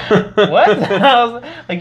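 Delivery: high, laughing disbelief. Real, not acted. Hearing the monk's Trump question, laughing in disbelief.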